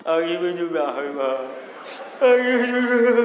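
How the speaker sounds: hammed-up wail with "Aku juga" smeared into mush; mock blubbering, "Aku juga" slurred beyond understanding; unintelligible sobbing. Child too tearful to speak to mother, garbled mock crying. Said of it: "Ada juga yang nggak jelas ngomong".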